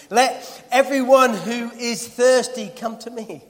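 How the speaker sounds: high, loud, slow call with a laugh; Jesus calling out to the festival crowd, loud; loud proclamation. Retold or imitated. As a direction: cry it out to the whole crowd. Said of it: "said in a loud voice".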